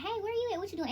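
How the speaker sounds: high, breezy pretend text; mock casual check-in. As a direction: ask it high and light, as if nothing's wrong. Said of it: "you call them or you text them like".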